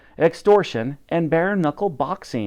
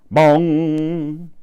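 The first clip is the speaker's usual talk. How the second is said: louder, voiced bell toll; clock bong; 3 semitones higher, 4 dB louder than usual. A narrator's reading. Narrator voicing Big Ben striking, a loud booming bong.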